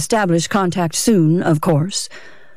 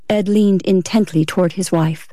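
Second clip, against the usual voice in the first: usual speaking voice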